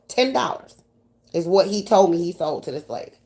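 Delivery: ordinary talk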